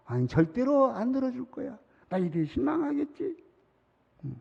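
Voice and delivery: ordinary delivery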